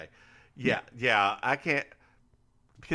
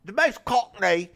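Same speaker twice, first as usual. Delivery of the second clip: put-on cockney accent; 5 dB louder, slower than usual. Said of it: "I don't even know what kind of accent I'm doing here".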